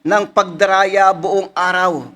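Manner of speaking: elevated preacher's reading; scripture reading. Acted. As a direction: proclaim the verse with mounting weight. Reads a psalm aloud.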